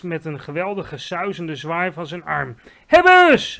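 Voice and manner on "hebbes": high, very loud cry of capture; triumphant shout. A narrator's reading. Giant crying out over his catch, triumphant shout. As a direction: shout it out at full voice. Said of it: "riep hij".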